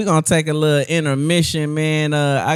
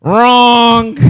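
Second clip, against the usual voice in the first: loud, high "wrong"; loud reveal; 7 semitones higher, 10 dB louder than usual. Real, not acted. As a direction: announce it loudly. Quiz answer reveal.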